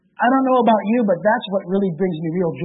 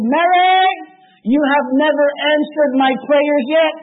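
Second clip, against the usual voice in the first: raised, louder pleading to a statue; exasperated plea; 5 semitones higher, 4 dB louder than usual. Retold or imitated. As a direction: complain heartbroken to Mary she never answered. Conchita confronting Mary statue.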